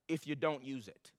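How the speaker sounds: usual speaking voice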